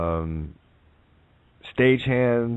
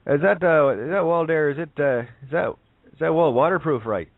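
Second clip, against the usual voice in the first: Newfie voice; 7 semitones higher than usual. Acted. Joke teller voicing the Newfie asking the genie, hammed-up high voice. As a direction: play a dim, eager Newfie in a joke. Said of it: "He says".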